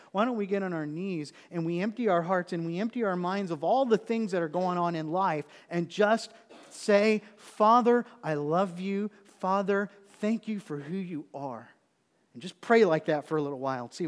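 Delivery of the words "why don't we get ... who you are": louder, building to a cried Father; fervent preaching. Acted. Pastor calling the church to pray, loud and fervent. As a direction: urge them to pray with passion.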